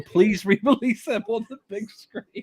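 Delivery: mock-pleading, high and laughing; sarcastic. Real, not acted. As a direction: beg for it with obvious sarcasm. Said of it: "I'm being sarcastic".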